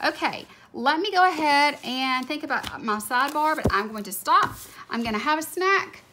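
ordinary talk, nothing marked

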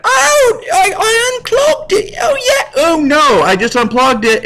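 high, shouted mock wail of a British kid; cartoonish high crying kid voice; hammed-up child voice. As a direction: yelp like a panicked little orphan. Child worker in machine accident.

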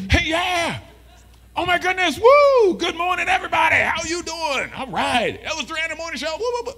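hyped, high-pitched morning show host; daytime radio DJ. Acted. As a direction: shriek the greeting, over-the-top energy. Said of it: "Daytime D J voice".

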